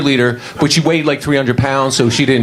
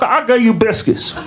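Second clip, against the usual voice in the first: much higher, outraged, dragging out biscuits; indignant yell; 11 semitones higher than usual. Retold or imitated. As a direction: complain hard about the unpaid biscuits. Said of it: "I was like".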